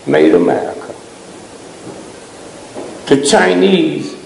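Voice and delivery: usual speaking voice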